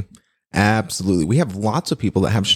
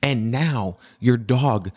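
normal talking voice

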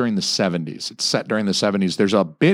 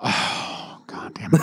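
breathy dismayed groan of disbelief; exasperated; 5 dB quieter, slower than usual. Real, not acted. Reacting to a friend who never saw the film, exasperated. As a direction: groan as if your friend failed you.

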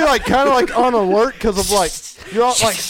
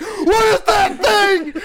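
higher, slow, frightened cry; 10 semitones higher, slower than usual. Retold or imitated. In the crawl space, seeing a dark shape, crying out afraid. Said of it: "And I'm just like".

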